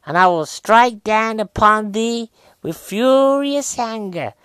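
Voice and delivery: slow, high, booming American accent; Sam Jackson. Shown as a demonstration. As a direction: declaim it in a hammed-up American accent. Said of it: "Sam Jackson".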